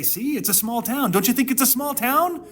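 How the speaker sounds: lightly voiced pleading Lot; mock pleading Lot. Lot's plea voiced mockingly.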